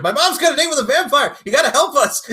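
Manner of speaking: put-on high and loud, a frantic kid begging for help; panicked kid voice. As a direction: squeal it like a scared kid pleading. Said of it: "leaves the message of".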